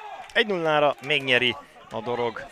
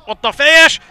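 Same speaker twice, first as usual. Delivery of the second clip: sudden shout of a header call; shouted commentary; 15 dB louder than usual. Real, not acted. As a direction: yell it out at full voice. Header called live.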